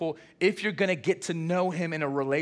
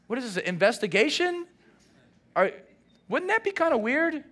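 baffled; 8 semitones higher than usual. Retold or imitated. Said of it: "you're like".

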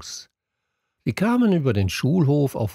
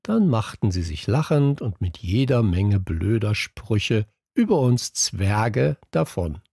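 ordinary delivery, nothing marked

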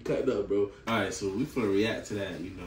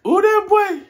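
excited squeal; 13 dB louder than usual. Real, not acted. Hyped exclamation, very high.

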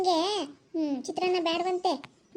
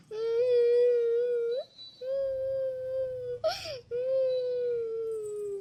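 slow, whimpering words between sobs; child crying; 3 semitones higher, much slower than usual. Acted. Grandson refusing lemon rice, crying to grandmother. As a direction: sulk and sob like a hurt little boy. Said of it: "ಯಾಕ ಅಳ್ತಾ ಇದ್ಯಾ?".

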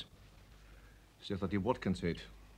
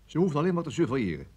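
fast, louder defensive rush; hurried excuses; 5 semitones higher, 6 dB louder, faster than usual. Acted. Officer justifying his men to the boss, fast.

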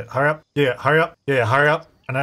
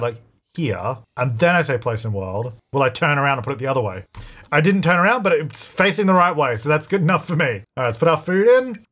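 ordinary delivery

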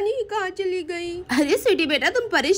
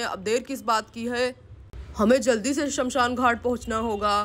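put-on lower, grave voice of an old relative; elderly man; 4 semitones lower than usual. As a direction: speak as the solemn head of the family. Elder directs the funeral.

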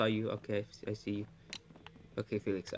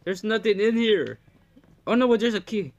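excited; 7 semitones higher, 7 dB louder than usual. Real, not acted. Searching spooky house in game.

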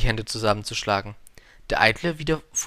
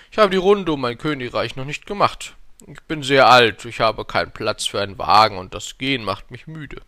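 lightly voiced old king, emphatic; a touch of an aged ruler explaining himself; 7 dB louder than usual. A narrator's reading. Old king excuses himself to the prince, lightly voiced and emphatic.

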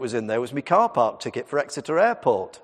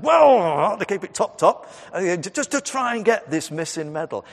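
defensive dad; 5 semitones higher, 5 dB louder than usual. Retold or imitated. Dad defending petrol trips.

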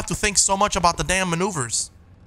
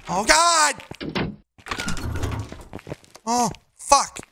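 scared horror game streamer; 9 semitones higher, much slower than usual. Real, not acted. Scared streamer in dark house.

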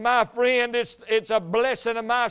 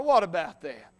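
usual speaking voice

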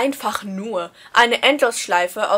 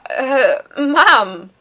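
a mortified, louder yelp of protest; horrified cry; 3 semitones higher, 9 dB louder than usual. Acted. Son caught by his mom with a letter, horrified cry. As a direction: cry out in embarrassed shock. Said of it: "rief ich entsetzt".

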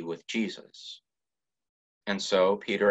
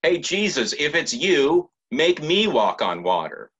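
casual, pushy, louder and higher; brash demand; 8 semitones higher, 6 dB louder than usual. Retold or imitated. Peter as he does not speak, a loud brash demand. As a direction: toss it off like a dare. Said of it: "Peter does not say".

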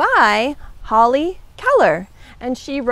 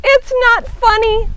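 high, loud storybook yell; 10 semitones higher, 5 dB louder than usual. A narrator's reading. Mouse in the hole to laughing Hedgehog, yelling. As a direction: protest in a high, raised voice. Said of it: "Mouse yelled".

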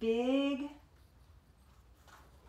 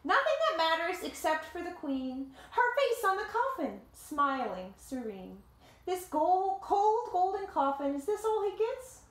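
lively read-aloud voice, higher than the chat; story narration; 7 semitones higher, 3 dB louder than usual. A narrator's reading. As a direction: tell it like a picture book to kids. Reading the picture book aloud.

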